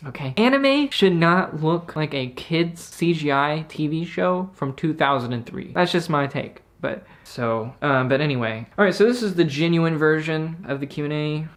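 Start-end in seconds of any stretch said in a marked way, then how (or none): 0.4-5.7 slow, weighty, each word pressed; slow emphatic. Real, not acted. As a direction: lay it down like a final verdict. Emphatic verdict on anime CGI.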